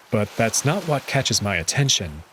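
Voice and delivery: usual speaking voice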